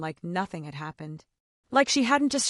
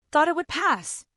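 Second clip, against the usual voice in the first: higher-pitched, mildly indignant echo; voice rising; 7 semitones higher than usual. A narrator's reading. Husband repeating his wife's words, voice rising. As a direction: repeat it back with the pitch lifted. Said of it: "my voice rising".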